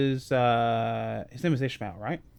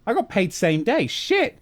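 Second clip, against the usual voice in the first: mock realisation; 6 semitones higher, 3 dB louder than usual. Retold worker realising pay timing.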